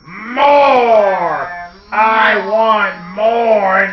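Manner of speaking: loud, high, drawn-out bellow. Acted. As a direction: bellow it slowly, insatiably hungry. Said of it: "and still he yelled".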